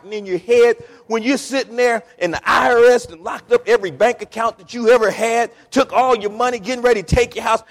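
high, loud, driving preacher's voice; full-force preaching. Acted. Preacher asking about peace amid trouble, high full-force delivery. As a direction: pile it up with rising fervor.

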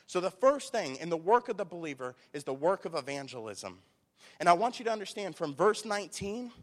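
loud, punched sermon points; emphatic preaching. Real, not acted. Preacher tells church of persecuted believers, emphatic preaching. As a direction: drive each point home forcefully.

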